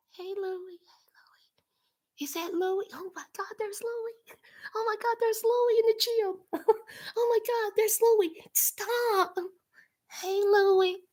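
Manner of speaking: hushed, whispery crush-struck mimicry; mock stalker whisper. Mocking her peeking at Louis from hiding, stalker whisper. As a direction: imitate a secret admirer, whispering then softly voiced.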